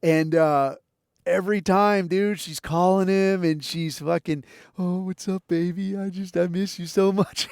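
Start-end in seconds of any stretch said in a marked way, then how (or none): 4.8-7.5 half-hearted breathy-girl impression, not overdone. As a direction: lightly ham up a needy girlfriend on the phone. Imitating stripper calling her man.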